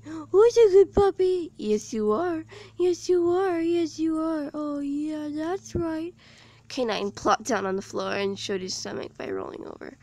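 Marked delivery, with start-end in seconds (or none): 0.0-6.1 high, cooing pet voice; Lily sweet-talking the robot dog; baby talk to a dog. A narrator's reading. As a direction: coo at the puppy like a doting owner.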